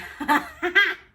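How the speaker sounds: loud high laugh; burst of laughter. Real, not acted. Laughing at spicy pain.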